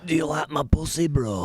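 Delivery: put-on Hull woman; Hull accent. Co-host says dancer's line on request, mock Hull.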